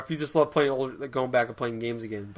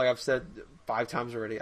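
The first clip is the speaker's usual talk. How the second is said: usual speaking voice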